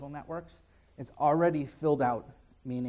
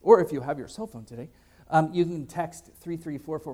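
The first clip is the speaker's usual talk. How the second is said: louder, punchy TV pitchman read; infomercial voice; 3 semitones higher, 8 dB louder than usual. Shown as a demonstration. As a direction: sell it like a late-night ad. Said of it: "I sound like an infomercial".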